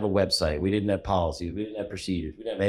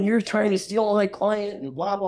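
high-pitched imitation of an angry yell; retold yelling; 13 semitones higher, 4 dB louder than usual. Retelling his partner yelling about a stolen client, high mock yell. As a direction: mimic a furious business partner yelling down the phone. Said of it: "starts yelling at me".

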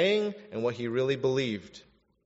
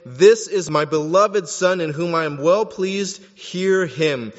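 loud, raised proclamation; God's voice from the cloud; 4 semitones higher, 10 dB louder than usual. Acted. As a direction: proclaim it from the heavens. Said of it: "a voice came out of the cloud saying".

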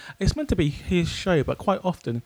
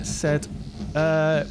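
ordinary talk, nothing marked

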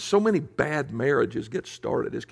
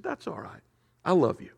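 much softer, tender and unhurried; gentle reassurance; 4 semitones higher, 4 dB quieter than usual. Retold or imitated. Father gently reassuring his little girl, softly. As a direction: ease her fear, barely above a hush.

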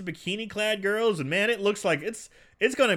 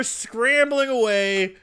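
ordinary talk, nothing marked

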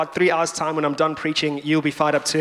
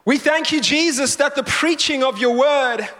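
prayer; 3 semitones higher than usual. Real, not acted. Pastor praying for the church after the sermon opening, earnest prayer. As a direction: pray over the room, unhurried.

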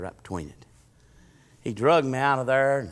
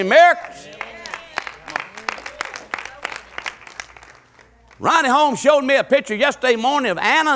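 high, loud preaching shout; full-force preaching; 14 semitones higher, 10 dB louder than usual. Real, not acted. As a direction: cry it out to the congregation like a revival preacher.

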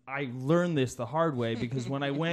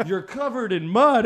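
laughing; 7 semitones higher, 8 dB louder than usual. Retold or imitated. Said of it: "he's like".

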